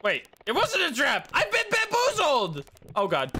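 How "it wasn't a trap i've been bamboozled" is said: high wail of betrayal; indignant shock. Real, not acted. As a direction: cry out at being fooled. Discovers he was tricked.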